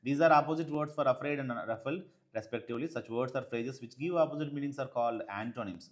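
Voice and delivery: slow, careful reading of the English text; textbook read-aloud. Acted. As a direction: read it out slowly for the class. (నెమ్మదిగా పాఠం చదవడం)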